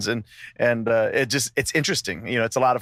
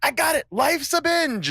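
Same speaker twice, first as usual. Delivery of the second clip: excited eureka; 11 semitones higher than usual. Retold or imitated. Retelling a eureka moment.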